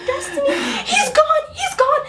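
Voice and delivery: very high mock sobbing through laughter; hyperventilating cry imitation. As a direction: wail like a friend too hysterical to speak. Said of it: "you couldn't even make out words 'cause you're hypervent".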